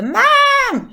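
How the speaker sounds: loud, high, drawn-out yell; long, piercing call at full volume; kid's shout for Mom. Shown as a demonstration. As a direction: holler for Mom like a child. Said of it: "shout that my kids do".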